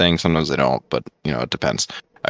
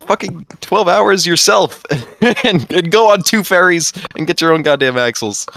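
mock possessive outrage; 11 semitones higher than usual. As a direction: play up a greedy, indignant refusal. Acted reply to people begging axles.

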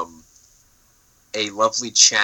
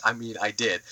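ordinary delivery

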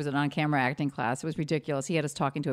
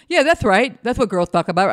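mock teacher; 5 semitones higher, 9 dB louder than usual. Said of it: "He's like".

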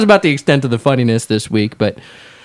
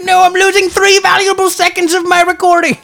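mock frustration; 4 dB louder than usual. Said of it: "and you're like".

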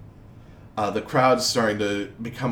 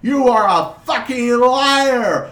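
much higher, loud, slow furious shouting; furious crowd; 11 semitones higher, 9 dB louder, slower than usual. Acted. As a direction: spit the insults at the duke. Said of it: "the crowd just says".

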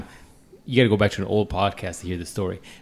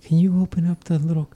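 higher, hesitant customer asking a favor; awkward request; 4 semitones higher than usual. Acted. Cornered shopper asking staff to open a cabinet, awkward. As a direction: timidly ask for help in a higher voice.

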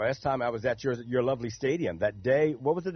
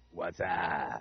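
Whassup ad voice; 9 semitones lower, 6 dB quieter than usual. Retold or imitated. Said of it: "you loved the".

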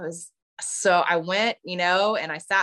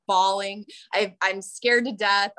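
ordinary talk, nothing marked

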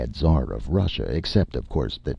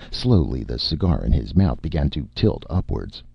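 ordinary delivery, nothing marked

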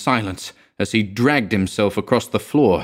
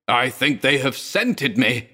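shrieked; 5 semitones higher than usual. A narrator's reading. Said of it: "he shrieked".

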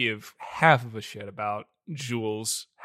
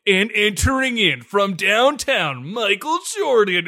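big, loud, raised announcer voice; mock arena announcer; 5 semitones higher, 5 dB louder than usual. Host parodies introducing Michael Jordan, hammed-up arena announcer. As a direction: hype up the star's entrance, loud and high.

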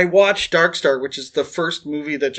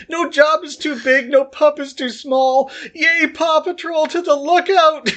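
put-on much higher, childlike excited squeal, slowing at the end; gushing cartoon fan; 8 semitones higher than usual. Groggy on pain meds, gushing to Nick about Paw Patrol, high giddy squeal. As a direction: cheer it like a giddy kid watching cartoons. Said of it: "It's like".

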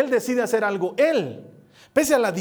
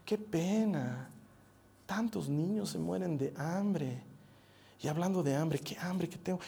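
slow, much softer, offhand pity; mock indifference; 3 semitones lower, 12 dB quieter than usual. Viewer shrugging at hunger news, offhand mock pity. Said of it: "ves la noticia y dices".

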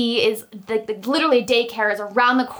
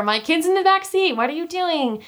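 mock yell; 7 semitones higher than usual. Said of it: "probably yelling at her".

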